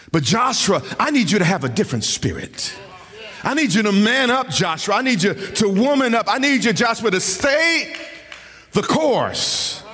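high, fast, pressing sermon delivery; impassioned preacher pleading with his flock; fervent preaching. Acted. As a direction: drive it home with rising urgency. Preacher rises in pitch.

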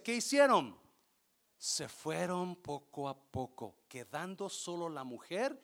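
ordinary talk

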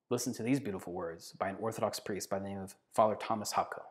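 normal talking voice

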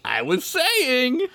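high, drawn-out put-on whine; exaggerated high-pitched protest; mock told-you-so. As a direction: stretch it high, hammed-up. Continuing the smug brother bit.

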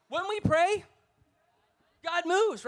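loudest, highest take of the refrain; preacher crowning his refrain loud and high; peak of the refrain. Acted. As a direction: hit the top of the build.

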